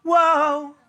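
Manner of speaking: high mock whoa; imitating the audience's whoa. Singer mimicking the audience's too-early whoa. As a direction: copy the crowd's eager whoa. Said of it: "you all went".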